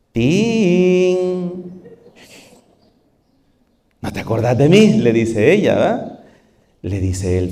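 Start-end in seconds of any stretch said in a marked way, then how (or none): 0.1-4.9 sly woman. Acted. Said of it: "toda maliciosa".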